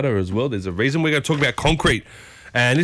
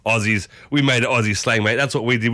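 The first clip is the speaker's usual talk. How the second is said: normal talking voice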